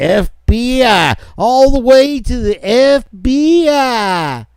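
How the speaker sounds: very high, drawn-out mock proclamation; mock-grandiose. Real, not acted. Host ridicules a case going up to the FBI, mock-grandiose. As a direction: stretch it out high, hamming up the importance.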